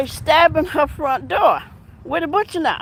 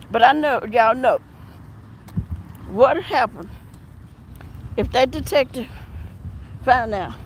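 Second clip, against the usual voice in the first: ordinary talk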